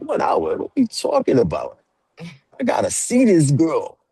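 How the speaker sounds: put-on high-pitched lovesick teen protest; hammed-up disbelief, stretched out; outraged protest. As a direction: plead it high, playing a heartbroken young boyfriend. Retells his lovesick protest at limit.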